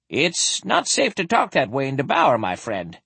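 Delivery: high character voice, dropping at the end; a mildly anxious word of caution; warning. Acted. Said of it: "Tanjin warned".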